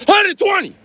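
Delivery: mock shouted answer. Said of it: "Don't let a little girl beat him to it".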